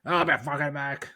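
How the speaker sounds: high cheeky cockney snarl; cockney accent. Retold or imitated. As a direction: toss it off in cockney. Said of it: "He gets all cockney".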